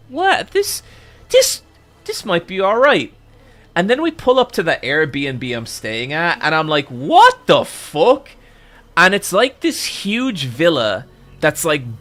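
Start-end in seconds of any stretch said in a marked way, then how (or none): 0.1-3.1 tentative surprise. Retold or imitated. Retold relief at nice neighborhood. 6.9-8.3 high, loud, drawn-out disbelief; astonished. Retold or imitated. Guest pulling up to a huge villa, loudly astonished.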